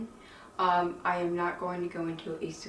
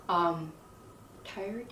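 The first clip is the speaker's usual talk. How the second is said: ordinary delivery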